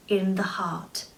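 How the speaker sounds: whispered reveal; dramatic whisper; hushed. A narrator's reading. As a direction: drop to a whisper for impact.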